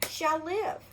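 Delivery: usual speaking voice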